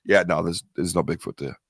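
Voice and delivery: low-pitched imitation of a doubter; dismissive sceptic. Man imitating science-minded people who doubt his Bigfoot sightings, dismissive. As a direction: brush it off low, like a mock sceptic.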